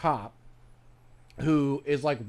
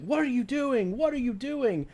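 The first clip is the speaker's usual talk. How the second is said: retold screaming; 9 semitones higher than usual. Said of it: "starts screaming at the cop".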